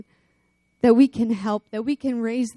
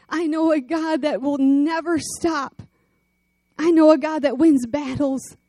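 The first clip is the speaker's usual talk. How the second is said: higher, louder rhythmic preaching; preaching at full force; 5 semitones higher, 4 dB louder than usual. Acted. Preacher proclaiming God's strength, full force. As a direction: drive each line home like a rally.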